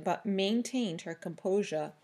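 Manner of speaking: ordinary delivery, nothing marked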